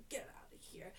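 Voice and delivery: quick, whispered dismissal; whispered send-off. Retold or imitated. Stylist sends off a finished client, whispered aside. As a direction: toss it off under your breath.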